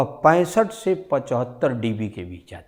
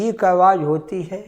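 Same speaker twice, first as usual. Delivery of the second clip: louder demo; 6 semitones higher, 4 dB louder than usual. Shown as a demonstration. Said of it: "साधारण तेज".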